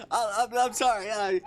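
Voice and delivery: high, loud apology through laughter; real helpless laughter. Real, not acted. Reader apologises for breaking, laughing hard. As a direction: stammer an apology while cracking up.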